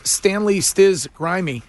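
ordinary talk

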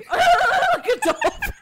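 very high, slow squeaky laughing; dolphin squeal. Pretend. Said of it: "she was like".

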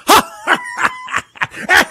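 very high, cackling imitation laugh; shrill hammed-up mocking cackle; mock lunatic laugh. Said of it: "She laughs like a lunatic".

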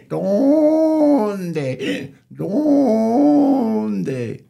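loud, high braying stretched over the word; donkey bray. Acted. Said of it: "the donkey started braying".

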